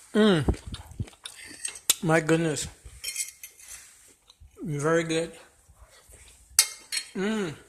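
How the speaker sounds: talking through a mouthful of omelet; chewing while speaking; mouth full. Real, not acted. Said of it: "to talk with my mouth full".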